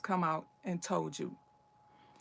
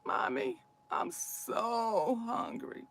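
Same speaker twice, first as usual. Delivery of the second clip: put-on slow, whimpering little kid crying from hunger; hungry child crying to mommy; slower than usual. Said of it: "they're crying big crocodile tears".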